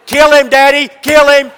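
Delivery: put-on high, loud little boy yelling; boy crying to his dad, loud mock scream; child screaming. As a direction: scream it like a tantrum. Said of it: "began to scream and cry".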